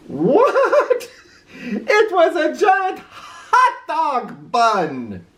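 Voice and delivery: high, slow aghast cry; shocked disbelief. A narrator's reading. Oscar gasping at the costume, high and aghast. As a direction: recoil in horror at the bun.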